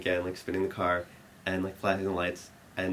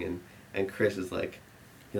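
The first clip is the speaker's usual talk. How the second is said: usual speaking voice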